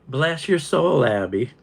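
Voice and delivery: mock Southern blessing. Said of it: "a swear word in the South".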